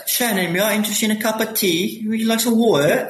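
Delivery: high, posh-sounding put-on British English accent offering tea. Joking to his co-host after moving to London, in a mock British accent. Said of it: "I should start speaking like, like the British".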